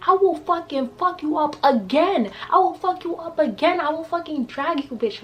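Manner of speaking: retold furious threat, voice pitched high; going off. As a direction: threaten her like you mean it. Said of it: "I was literally going off".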